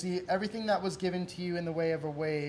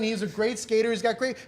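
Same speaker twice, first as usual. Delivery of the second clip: usual speaking voice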